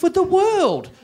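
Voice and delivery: high-pitched pleading whine. Acted. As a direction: beg the judges for another chance. Comic begging the judges.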